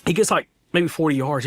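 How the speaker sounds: usual speaking voice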